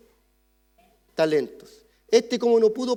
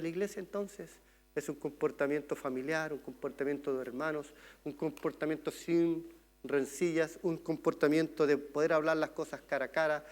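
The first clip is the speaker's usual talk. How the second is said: soft earnest appeal; 4 semitones lower, 8 dB quieter than usual. Real, not acted. Soft earnest exhortation closing.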